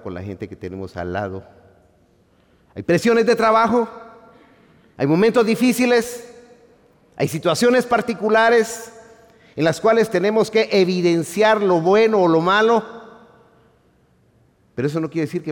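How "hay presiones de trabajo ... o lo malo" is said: louder, higher preaching cadence; full-force preaching. Acted. Preacher to congregation, full-force preaching. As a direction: preach it out over the pews.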